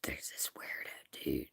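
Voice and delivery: hushed, secretive whisper. Real, not acted. Streamer starting gossip about a weirdo outside, whispering. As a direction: whisper it very softly, as if being overheard. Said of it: "Why am I whispering?".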